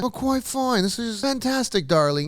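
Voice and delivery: high, hammed-up English accent; theatrical mock-English luvvie. Shown as a demonstration. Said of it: "have an English accent".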